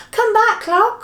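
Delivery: high, loud call after someone. Acted. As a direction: call it back from a distance. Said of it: "called Silky".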